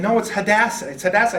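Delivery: wife correcting. Retold or imitated. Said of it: "Marie kept saying".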